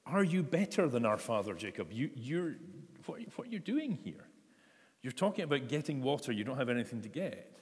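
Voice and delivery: who-do-you-think-you-are tone; a bit of attitude. Acted. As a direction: act out an indignant challenge with attitude. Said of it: "given him a bit of attitude".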